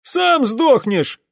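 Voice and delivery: high, loud drawn-out yell; young soldier shouting back; shouted retort. A narrator's reading. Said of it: "выкрикнул молодой улан". (злой громкий выкрик)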